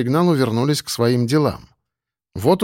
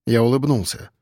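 plain reading voice

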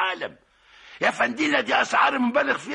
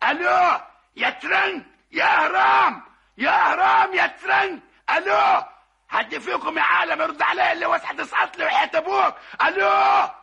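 shouting into phone; 4 semitones higher than usual. Acted. Yelling for the operator.

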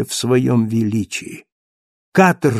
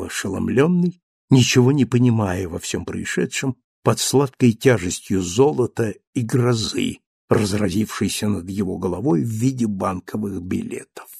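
plain reading voice

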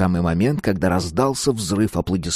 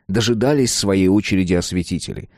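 usual speaking voice

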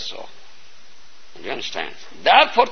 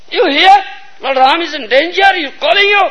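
alarmed urging; 7 semitones higher than usual. Acted. Sita urging Lakshman to go.

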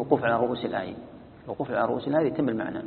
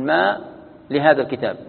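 ما held louder, a break, then لهذا الكتاب; pause after ma; 4 dB louder than usual. Shown as a demonstration. Teacher showing the first option, pause after ma. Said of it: "يقف على الماء".

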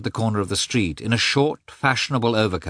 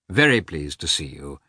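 reluctant politeness; 5 semitones lower than usual. Acted. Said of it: "a tone that expressed clearly that he was very sorry".

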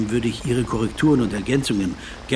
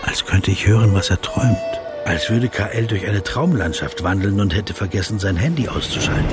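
story narration; 3 semitones lower than usual. A narrator's reading. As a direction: tell it to yourself like a reflective audio-book narrator. Inner narration over music.

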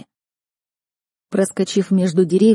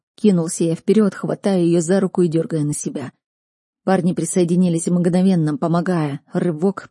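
ordinary delivery

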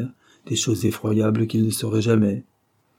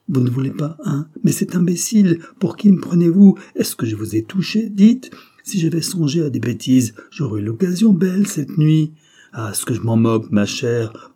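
loud, higher exasperated tirade; angry outburst; 6 semitones higher, 6 dB louder than usual. A narrator's reading. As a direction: lose your temper and berate her. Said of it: "il s'emporta tout à fait".

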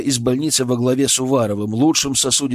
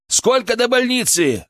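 higher shout to driver; 11 semitones higher than usual. A narrator's reading. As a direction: call out to the front. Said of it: "крикнул я водителю".

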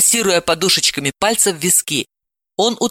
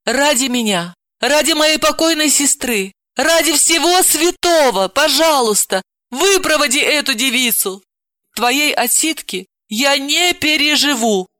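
higher, begging, building; pleading; 8 semitones higher than usual. Acted. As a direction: beg him earnestly. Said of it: "с мольбой попросила".

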